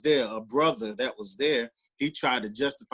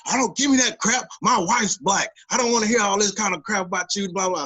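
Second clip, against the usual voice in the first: loud, high, indignant cop imitation; retold fussing; 7 semitones higher, 5 dB louder than usual. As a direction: mimic an irate officer fussing someone out. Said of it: "He started fussing now".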